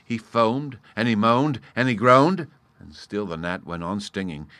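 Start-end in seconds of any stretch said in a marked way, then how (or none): none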